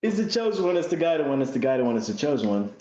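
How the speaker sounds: high excited start, settling down; playful hype-man call; mock hype. Hype chant mocking or hailing someone.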